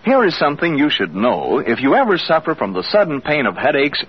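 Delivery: ordinary talk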